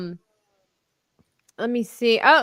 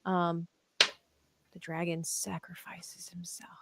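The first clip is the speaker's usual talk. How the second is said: whispering; 5 semitones lower, 12 dB quieter, slower than usual. Real, not acted. Spoiler dropped to whisper.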